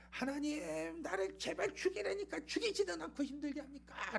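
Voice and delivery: high strained cry without real volume; imitated yelling. Jonah protests to God over his suffering, crying out. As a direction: wail at God in a high mock yell. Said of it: "막 소리를 지릅니다".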